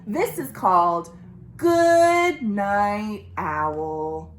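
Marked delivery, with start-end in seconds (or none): 1.6-4.3 slow, drawn-out title announcement; slow title read. Shown as a demonstration. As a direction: present the title slowly.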